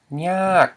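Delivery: nyak lifted then dropping; long falling-tone nyak. Shown as a demonstration. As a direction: say nyak drawn out with a pitch fall. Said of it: "with a falling tone".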